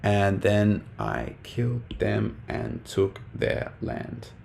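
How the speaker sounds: slow, dropping to almost a whisper; teacher replaying the punchline hushed and slow; quiet punchline. Shown as a demonstration. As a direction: let the punchline die away to nothing. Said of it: "And then the last one".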